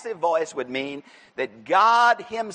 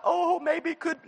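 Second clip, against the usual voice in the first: mock doubtful wish; 9 semitones higher, 3 dB quieter, slower than usual. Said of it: "It's not the".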